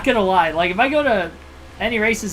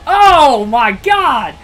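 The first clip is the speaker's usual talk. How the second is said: slow, high, loud gasped oh my God; drawn-out shocked shout; shocked exclamation; 8 semitones higher, 12 dB louder, much slower than usual. Real, not acted. Reacting to wreck.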